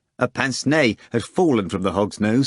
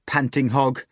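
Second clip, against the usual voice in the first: ordinary delivery, nothing marked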